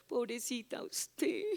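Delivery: soft, slow fake commiseration; mock pity. Preacher mimics devil pitying an attacked believer, mock pity. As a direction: coo it with exaggerated sympathy.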